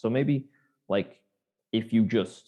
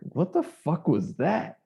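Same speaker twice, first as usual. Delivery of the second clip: slow, higher, incredulous; baffled; 7 semitones higher, slower than usual. Real, not acted. Podcaster reacting to the odd scene, baffled. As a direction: drag out the disbelief. Reacting to actor's strange line.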